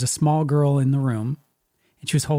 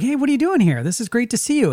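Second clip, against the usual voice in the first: bright, much higher happy hello; cheery greeting; 9 semitones higher than usual. Retold or imitated. Dead parents happily greeting the sister in the dream, cheery. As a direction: greet her like old times, lit up.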